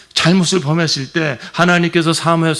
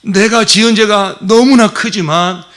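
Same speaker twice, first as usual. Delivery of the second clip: pleading confession; 7 semitones higher, 6 dB louder than usual. Acted. David's confession voiced.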